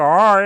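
loud, exaggerated curled R; "R" hammed up with tongue curled hard; greasy overdone R. Teacher mocks learners curling everywhere, overdone greasy R. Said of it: "아무 데서나 막 느끼하게".

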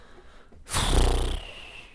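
long, loud exhale; exasperated sigh. Real, not acted. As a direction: blow out a heavy sigh. Exasperated by laggy game.

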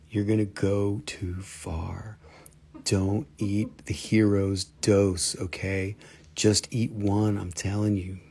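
low, slow, spooky warning; slow down and warn darkly; ominous warning. Acted.

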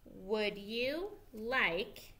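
teacher reading along slowly with the class; slow choral reading. Shown as a demonstration. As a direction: read it slowly together. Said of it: "Read it with me".

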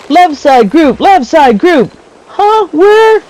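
high-pitched mock battlefield callout; playful, squeaky-high spotter; role-playing squad spotter. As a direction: call it out to a squad that can't hear. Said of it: "So if I'm role-playing".

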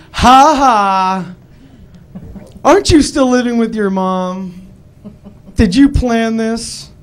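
high, loud taunting voice; mocking friend. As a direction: sneer it out, slow at the end.

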